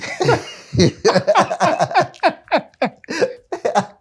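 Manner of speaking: long burst of high laughter; loud high laughter. Real, not acted. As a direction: laugh out hard at the story. Laughing at uncle's excuse.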